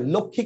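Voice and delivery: final "লক্ষ্মী" said laksh-mee, sounding sh and m; Hindi pronunciation. Shown as a demonstration. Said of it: "এটা হিন্দি উচ্চারণ".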